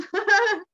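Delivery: a high, loud burst of laughter. Real, not acted. Mocking own limiting belief, a loud high laugh. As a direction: laugh at yourself.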